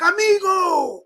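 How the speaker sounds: high, drawn-out mock welcome; mock effusive greeting. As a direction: imitate an over-eager shopkeeper hailing a customer. Shopkeepers greeting the customer.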